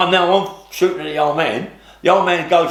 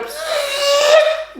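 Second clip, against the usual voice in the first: high squealing laugh breaking out; burst of laughter. Real, not acted. Retelling how a bullet missed his ear, high burst of laughter. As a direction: laugh high at the near miss.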